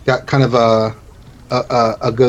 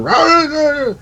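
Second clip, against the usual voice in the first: high wordless shouting noise; garbled high-pitched yell, acted; imitated muffled hollering. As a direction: mimic someone calling out from inside. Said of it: "you hear him".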